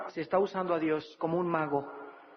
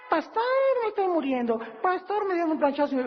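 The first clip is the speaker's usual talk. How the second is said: whiny plea; 10 semitones higher than usual. Retold or imitated. Congregant begging the pastor.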